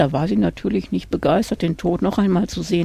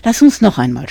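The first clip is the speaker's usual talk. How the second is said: louder pleading request; 9 dB louder than usual. A narrator's reading. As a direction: beg for one more game, louder. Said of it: "bat er". (lauter, bittend flehen)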